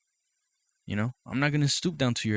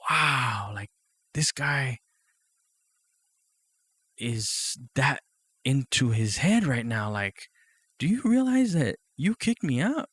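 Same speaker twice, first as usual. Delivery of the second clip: disbelief; 2 semitones higher, slower than usual. Retold or imitated. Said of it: "And I'm like".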